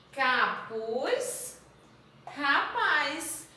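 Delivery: each word spoken slowly with a pause; slow word drill. Shown as a demonstration. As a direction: read each word clearly for children to repeat. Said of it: "Repete com a tia". (lista de palavras para repetir, pausada)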